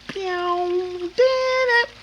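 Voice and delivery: high squealing mimic of a synth sample; vocal sample sound. Recalling the Kraftwerk track he found, high mimic. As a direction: sing out the record's sound way up high.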